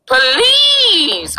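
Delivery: high, long pleading please; begging. Real, not acted. Begging the chat, high pleading whine. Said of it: "I'm about to cry".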